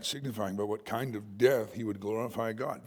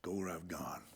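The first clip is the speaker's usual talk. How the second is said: intense whispered call; Jesus softly whispering the call to follow; hushed whisper; 8 semitones lower, 14 dB quieter than usual. Retold or imitated. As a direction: breathe it close, with quiet intensity. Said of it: "I think he said it like that".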